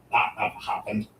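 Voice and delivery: hushed snide aside, imitating Morik; a quiet sneering put-down; mocking whisper. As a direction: lean in and sneer it under your breath. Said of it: "In his voice".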